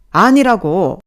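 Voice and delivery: explosive loud repeat of "아니라고"; furious shout. Acted. Boss repeats 아니라고 at the top of his voice. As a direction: yell "아니라고" at full force.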